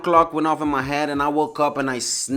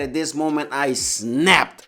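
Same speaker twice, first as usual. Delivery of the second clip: ordinary delivery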